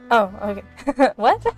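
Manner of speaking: usual speaking voice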